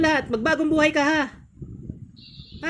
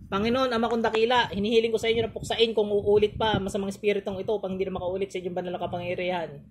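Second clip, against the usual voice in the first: fading, softer and softer prayer; solemn prayer; 3 semitones lower, 4 dB quieter than usual. Acted. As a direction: pray reverently, letting the voice sink away. Closing prayer to God. (taimtim na panalangin na humihina)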